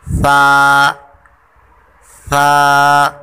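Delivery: सा called loud with tongue on teeth; tha drill, no whistle. Shown as a demonstration. Teacher drilling the class on सा, loud. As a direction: drill सा firmly with no hiss. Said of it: "सीटी की आवाज़ जो है ये बिल्कुल ना हो".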